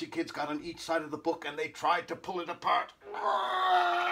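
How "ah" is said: long, very high wail; drawn-out high scream; pained yell. A narrator's reading. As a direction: shriek as if being pulled apart. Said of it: "yelled Haley".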